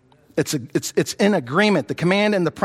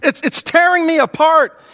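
higher, louder cry of distress; desperate plea to God; anguished prayer; 6 semitones higher, 3 dB louder than usual. Retold or imitated. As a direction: pray like someone being torn apart. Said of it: "when we're pulling apart at the seams".

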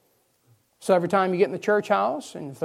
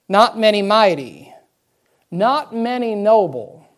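slow, loud, emphatic scripture reading; slow right down and land each word loudly; 2 semitones higher, 8 dB louder, slower than usual. Shown as a demonstration. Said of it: "we'll try to slow her down a little".

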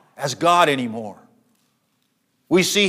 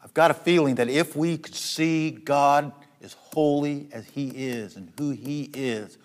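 normal talking voice

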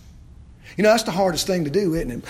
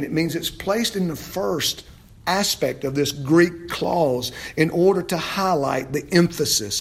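ordinary talk

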